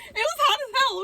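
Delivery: "hot" shouted high and loud; loud high complaint "It was hot as hell"; high exasperated shout. Real, not acted.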